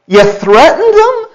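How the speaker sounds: incredulous. Real, not acted. Incredulous remark.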